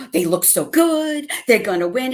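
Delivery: mock giddy sister; excited fan. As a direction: gush like a confident fan. Sister cheering at halftime, quoted.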